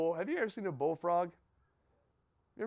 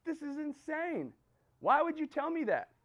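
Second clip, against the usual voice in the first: exasperated disbelief; 9 semitones higher than usual. Retold or imitated. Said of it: "He's like".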